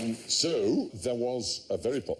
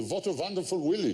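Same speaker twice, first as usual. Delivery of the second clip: "Wonderful Willy" with W for the V, lightly voiced old German; a hint of an old German grandfather; grandfather's German accent; 9 semitones higher than usual. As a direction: mimic grandad saying "Willy" for village. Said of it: "he used to go".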